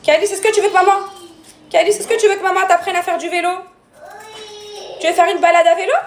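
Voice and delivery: mother coaxing upset son, hopeful; coaxing offer. Real, not acted. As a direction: tempt him out of his sulk. Coaxing son with bike ride.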